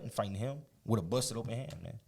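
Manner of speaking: normal talking voice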